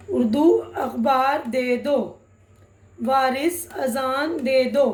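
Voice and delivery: slow, deliberate word by word; slow reading demo. Shown as a demonstration. Teacher reading a practice sentence slowly to students. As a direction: read it out slowly for learners to follow. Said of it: "अब यहाँ छोटे छोटे sentence है".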